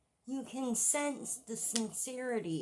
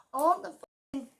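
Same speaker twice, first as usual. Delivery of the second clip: ordinary talk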